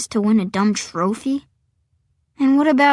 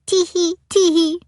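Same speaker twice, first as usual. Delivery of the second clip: high, birdlike tee-hee; bird laugh; 9 semitones higher than usual. A narrator's reading. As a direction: titter like embarrassed birds. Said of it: "They all do their bird laughs again".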